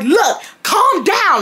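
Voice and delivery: high, drawn-out mock order; stretched-out scolding; bossy. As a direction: imitate her slow, high telling-off. Retold order in the quarrel.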